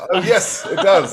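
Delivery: high, loud posh British captain; posh British accent. Acted. Captain confirming the ship has cannons, posh British accent. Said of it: "he says in a posh British accent".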